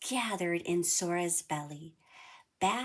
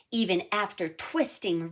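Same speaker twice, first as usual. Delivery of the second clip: ordinary delivery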